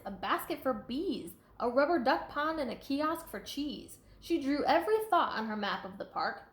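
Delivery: lively, sing-song storybook read; reading to children. A narrator's reading. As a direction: read it out to a class with bounce. Reading picture book aloud.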